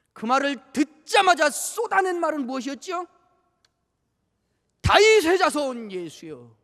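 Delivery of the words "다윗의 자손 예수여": loud, slow, drawn-out cry; proclaiming cry. Acted. Preacher voices the blind man crying to Jesus. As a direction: cry out the verse for mercy.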